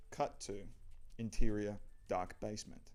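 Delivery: ordinary talk, nothing marked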